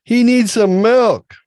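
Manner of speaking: high, loud teen slang put-on; dad using teen slang loudly on his kids; mocking teen lingo. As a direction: ham up the kids' slang back at them. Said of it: "use their lingo back at them".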